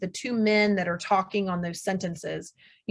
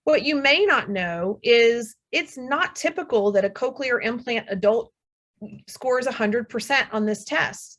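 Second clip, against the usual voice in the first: ordinary talk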